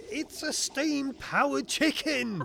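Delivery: high, slow, emphatic outcry; exasperated protest. Acted. Inspector throws inventor's boast back at him, exasperated. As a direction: spell out the absurdity at high pitch.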